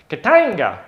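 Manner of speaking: loud, high KATANGA with a soaring TANG; big showy exclamation of the name; game-name battle cry. Shown as a demonstration. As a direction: shout it like a rallying call. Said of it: "say it like that as well".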